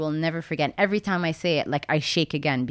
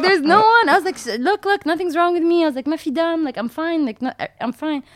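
retold protest in a much higher voice; high-pitched insistence; 10 semitones higher, 3 dB louder than usual. Crash victim convincing cop she is unhurt, high-pitched insistence.

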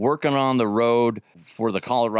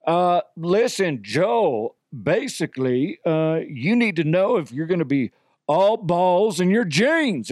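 Cowboy DeHuff; 6 semitones higher than usual. Retold or imitated. Resident cowboy gives Joe fashion advice, in mock cowboy voice. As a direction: play an improvising cowboy advice-giver. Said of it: "Cowboy DeHuff. Uh, what, what advice do you got?".

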